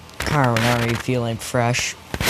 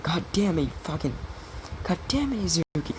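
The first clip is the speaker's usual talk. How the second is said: high-pitched, softer mock outburst; mock angry; 7 semitones higher, 7 dB quieter, faster than usual. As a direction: squeal in held-back fake fury. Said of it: "he gets pissed".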